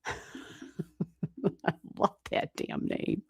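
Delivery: breathy giggling whisper, very slow; slow giggled whisper of delight; laughing whisper. Real, not acted. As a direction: whisper it through a real laugh. Laughing over a viewer's name.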